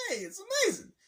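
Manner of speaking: very high, loud sarcastic yay; mock cheer. Mocking the family's cheery dinner talk, sarcastic. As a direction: cheer with fake enthusiasm. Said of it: "It's like".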